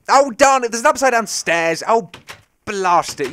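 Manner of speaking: playful outburst; mock frustration. Real, not acted. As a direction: curse at the stairs, hammed up. Stairs upside down again.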